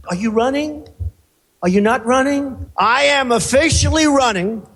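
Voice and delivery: ordinary delivery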